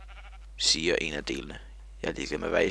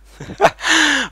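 high, loud real laugh; loud burst of laughter; 13 dB louder than usual. Real, not acted. Laughing at his own mistake, a loud high burst. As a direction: crack up at your own slip.

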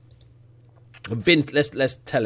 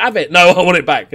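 1 taunting player; 3 semitones higher, 9 dB louder than usual. Retold or imitated. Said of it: "He's like".

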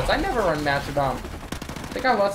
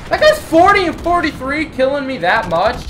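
high, loud complaint; outraged; 8 semitones higher, 8 dB louder than usual. Real, not acted. Gamer killed over and over by one player, outraged. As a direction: protest in raised-voice disbelief.

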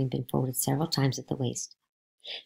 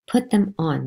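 a bit louder, firm repeat of the order; 6 semitones higher, 5 dB louder than usual. Acted. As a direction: repeat the command, tired of arguing. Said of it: "my mother said".

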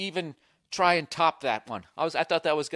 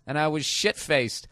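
ordinary talk, nothing marked

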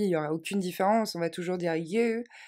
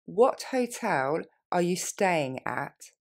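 what hotel are you staying at, slowly and clearly; slow it down for the learner; slower than usual. Shown as a demonstration. Teacher modelling for students, slow. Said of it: "Plus lentement".

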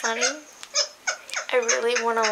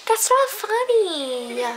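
high, slow, holding back a laugh; stifled laughter; 10 semitones higher, much slower than usual. Real, not acted. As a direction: squeak it out, fighting a laugh. Trying not to laugh.

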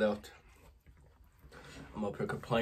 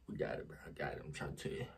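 strained murmur; 8 semitones lower, 11 dB quieter, faster than usual. Real, not acted. Struggling with spicy noodles.

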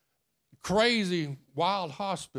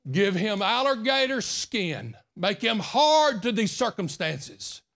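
yelled prayer; 5 semitones higher, 4 dB louder than usual. Retold or imitated. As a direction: belt out the retold prayer, drawing out the words. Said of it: "he wasn't yelling like that".

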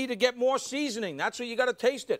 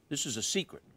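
softer, lower conspiratorial aside; hushed secret; 6 semitones lower, 7 dB quieter, faster than usual. Real, not acted. Chef confiding a secret to viewers, hushed. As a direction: let them in on a secret, voice dropped and softer.

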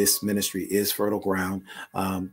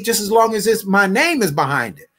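usual speaking voice

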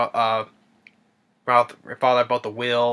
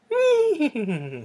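high, descending neigh; whinny like a startled horse; imitated horse whinny. Horses spooked by her name, high whinnying neigh. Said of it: "the horses get all wild up".

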